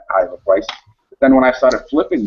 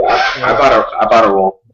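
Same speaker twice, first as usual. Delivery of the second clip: laughing; 6 dB louder than usual. Real, not acted. Laughing while admitting a purchase.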